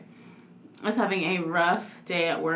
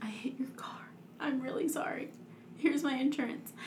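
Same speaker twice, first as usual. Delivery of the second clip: very soft, near-whispered mock confession; imitated tearful confession; 3 semitones higher, 12 dB quieter than usual. Imitating the crying boss admitting she hit the car, hushed. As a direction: retell it in a small, hushed voice. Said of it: "then she just starts crying".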